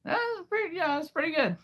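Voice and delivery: put-on high girl voice praising itself. Pleased with his new voice, very high girl voice. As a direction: squeak out a pleased compliment.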